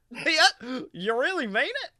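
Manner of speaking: eager Goofy. Acted. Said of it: "Goofy answers".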